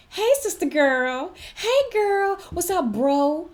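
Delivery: high, drawn-out mock greeting; put-on sing-out "hey girl" voice; overfamiliar coworker. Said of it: "someone is gonna say".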